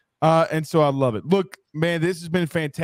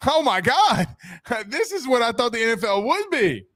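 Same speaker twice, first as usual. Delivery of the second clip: mock thrilled rookie; 6 semitones higher than usual. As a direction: play the rookie relieved to finally have a calm coach. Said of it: "You know, like".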